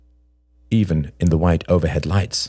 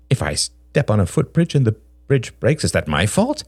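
lightly voiced defensive man, a bit higher; defensive Greg; 5 semitones higher than usual. A narrator's reading. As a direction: plead innocence in a raised pitch.

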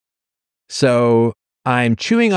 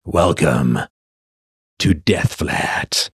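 ominous host voice; 7 semitones lower, much slower than usual. Pretend. Host greeting at smoky door.

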